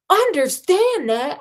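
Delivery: slow, high indignant shout. Acted. Arthur shouting at Ford over Earth's loss. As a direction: shout it back in disbelief. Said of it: "Arthur shouted".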